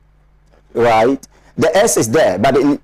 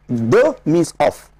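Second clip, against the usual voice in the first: usual speaking voice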